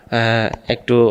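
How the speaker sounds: ordinary talk, nothing marked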